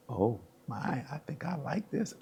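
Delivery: soft, rising private realization; quiet dawning delight. Retold or imitated. Player discovering receiver after two touchdowns, hushed delight. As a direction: say it softly to yourself, pleasantly surprised.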